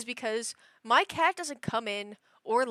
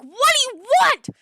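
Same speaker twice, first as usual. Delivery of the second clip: high, very loud retold outburst; exasperated yell; 13 semitones higher, 13 dB louder than usual. Owner woken at eight by her meowing cat, exasperated yell. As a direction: shout it as if just woken. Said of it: "I'll be like".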